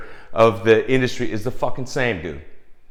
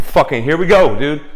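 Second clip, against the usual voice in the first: pumped-up self; 3 semitones higher, 7 dB louder than usual. Retold or imitated. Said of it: "you're like".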